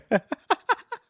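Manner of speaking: sudden squeaky laugh breaking out; high burst of laughter. Real, not acted. Gamer cracking up at a friend's message, squeaky laugh. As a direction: laugh high and loud at the joke.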